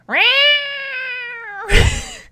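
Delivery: long high yowling cat scream; hangry cat meow. Pretend. Streamer imitates her hungry cat for chat, loud yowl. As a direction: yowl like a starving cat at the kitchen. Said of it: "So that's the hangry meow".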